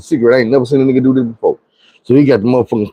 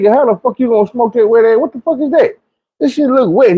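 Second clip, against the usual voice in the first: high-pitched, disbelieving squeal at his friend; staring at the soggy joint, can't believe it; incredulous disbelief; 8 semitones higher than usual. Retold or imitated. To his friend rolling a wet joint, high incredulous disbelief.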